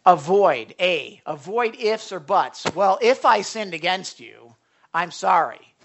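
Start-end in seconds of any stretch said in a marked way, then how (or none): 2.8-5.7 loud, half-hearted "if" apology; mock insincere apology; grudging apology. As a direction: throw out a backhanded sorry. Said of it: "that's almost like a smack in the face".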